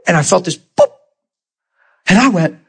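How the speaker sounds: ordinary talk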